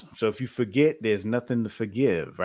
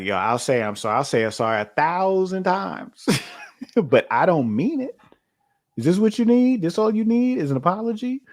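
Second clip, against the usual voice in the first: exasperated, rising higher as it builds; mock insincere apology; 7 semitones higher than usual. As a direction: act out a grudging apology, climbing into high-pitched questions. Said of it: "you don't wanna get an apology".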